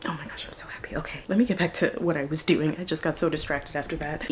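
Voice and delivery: hushed, breathless joy; happy whisper. Real, not acted. Murmuring to herself after dad finally listened. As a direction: whisper it to yourself.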